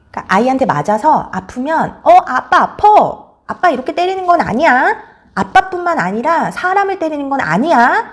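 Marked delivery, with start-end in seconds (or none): 2.0-8.1 high, emphatic telling-off without rage; stretched, raised-pitch acted parent's reprimand; instructive parental correction. Shown as a demonstration. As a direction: say it hurts, then correct the child clearly. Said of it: "라고 알려주시고".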